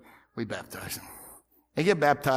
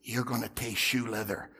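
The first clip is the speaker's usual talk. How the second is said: low, hushed menacing whisper; whispered threat; 6 semitones lower, 9 dB quieter than usual. Retold or imitated. Pastor leaning over the pew to threaten Alvin, whispered. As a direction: lean in and threaten under your breath.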